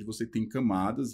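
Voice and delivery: ordinary delivery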